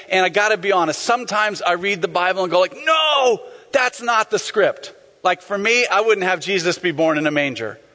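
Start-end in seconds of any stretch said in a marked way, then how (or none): 2.8-4.9 high cry of objection, then slowing; mock protest. Preacher protesting at the Bible story, mock outcry. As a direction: draw out the no in comic dismay.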